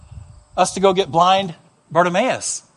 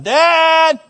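imitating a small boy hollering for water; little boy calling; 6 semitones higher than usual. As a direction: call out to dad, pitched up. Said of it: "the boy said".

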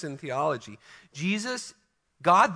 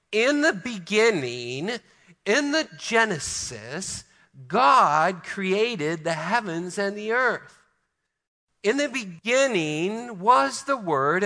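higher, slow, ringing reading of scripture; scripture recitation; 4 semitones higher, 3 dB louder than usual. Acted. Preacher reciting John one to his congregation, slow and raised. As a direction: proclaim the verses with awe.

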